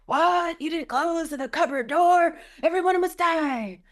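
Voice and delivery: hammed-up shriek, high and loud; mock rage. She mocks herself raging at family over a cupboard door, a high loud hammed-up shriek. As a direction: screech it like an overblown tyrant at home. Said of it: "in my family life, I'm quite the opposite".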